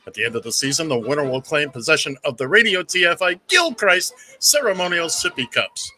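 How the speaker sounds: booming game-show announcer over music; radio show announcer. Acted. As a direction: sell the segment like a big radio opener. Opens show segment over music.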